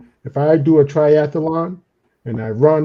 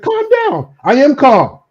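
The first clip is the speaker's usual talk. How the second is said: loud, very high-pitched mock yell; acted shrieked denial of being worked up; noisy protest of calm; 11 semitones higher, 6 dB louder than usual. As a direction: insist you're calm while yelling. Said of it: "I'm gonna make some noise".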